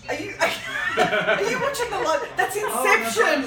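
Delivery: amused, words broken by laughs; laughing. Real, not acted. Laughs at being watched live.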